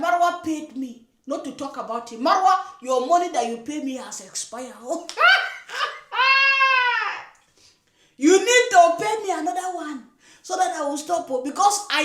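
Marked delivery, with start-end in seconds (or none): 4.8-7.2 shrill burst of mocking laughter; a high, gleeful taunting laugh. Real, not acted. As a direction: cackle at them in scorn. Laughs mocking expired money.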